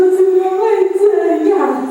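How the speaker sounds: loud, high, slow sobbing cry. Retold or imitated. Crying in church as strangers hug her, loud sobbing cry. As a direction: wail it out through tears. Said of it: "我还一边哭一边说".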